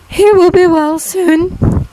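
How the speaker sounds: high, slow otherworldly woman's voice; murmuring wise Keeper. A narrator's reading. Keeper kneeling over the unconscious boy, high soothing murmur. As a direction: soothe slowly in a high, lilting voice. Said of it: "she murmured softly".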